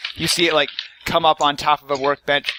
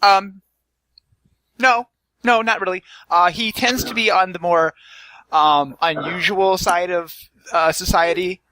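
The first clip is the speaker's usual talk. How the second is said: higher nervous NPC voice; a hesitant, fluttery helper; Tabitha voice; 5 semitones higher, 3 dB louder than usual. Acted.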